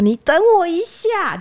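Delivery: put-on slow, drawn-out words of a very old woman; slow old granny. Old granny telling the customer to wait, slowly. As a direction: take your time like an elderly lady. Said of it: "讲话很慢，然后都讲台语".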